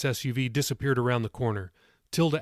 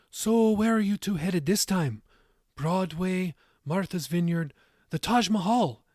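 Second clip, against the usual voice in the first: higher-pitched Irish grandmother; moderate Irish accent; 7 semitones higher than usual. A narrator's reading. Tilda asks the couple about their date, moderate Irish accent. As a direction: tease with questions in an Irish lilt. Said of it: "in her moderate Irish accent".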